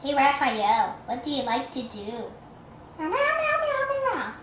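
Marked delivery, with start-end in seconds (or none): 3.0-4.3 high-pitched meowing in a run, dropping at the end; playful high cat imitation; pretend kitten meows. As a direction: meow back like a kitten answering. Voicing the kitten's answer.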